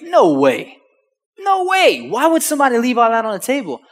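high, loud imitation of an incredulous dad; disbelieving. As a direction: exclaim it as a father who cannot believe it. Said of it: "My dad's not believing this".

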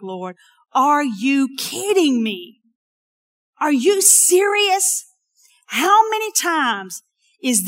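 loud, high, slow exasperated cry at God; furious frustrated prayer. Retold or imitated. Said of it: "I was so mad".

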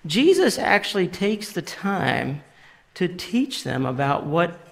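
ordinary talk, nothing marked

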